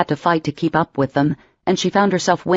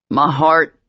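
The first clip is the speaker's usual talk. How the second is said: moaning. Acted. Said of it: "he moaned".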